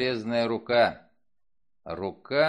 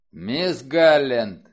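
slow, thunderous loud call; booming; 9 dB louder, much slower than usual. Acted. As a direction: thunder the name out slowly. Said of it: "прогремел голос Вульфа".

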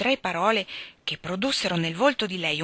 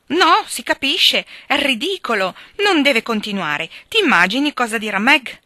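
louder, higher, outraged protest; 6 semitones higher, 8 dB louder than usual. A narrator's reading. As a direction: protest it, scandalised. Joe outraged by the secret.

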